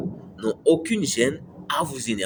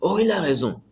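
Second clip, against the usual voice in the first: lightly voiced gullible viewer, pitched up; a viewer nodding along without thinking, said higher; mock naive agreement; 7 semitones higher than usual. Vlogger mocking a gullible follower, high naive agreement. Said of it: "ne regarde pas la vidéo et dis".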